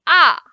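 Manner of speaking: "ah" falling steeply from high; short, loud falling "ah"; fourth tone. Shown as a demonstration. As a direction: drop "ah" straight from top to bottom. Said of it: "we are going down".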